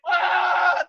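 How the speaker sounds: high, loud startled cry; startled yelp. Retold or imitated. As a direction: yelp as they snap the photo. Photo taken, head held up.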